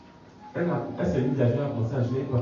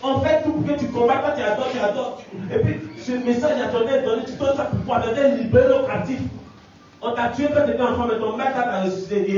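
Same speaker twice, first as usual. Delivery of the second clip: much higher, louder demon taunting; possessed girl's voice; 9 semitones higher, 4 dB louder than usual. Retold or imitated. Demon speaking through the girl, taunting the preacher. La fille entre dans tous ses états.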